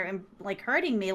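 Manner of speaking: usual speaking voice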